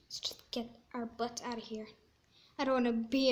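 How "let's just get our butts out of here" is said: hushed and breathy; whispered. Real, not acted. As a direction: whisper it to yourself. Repeats leaving line.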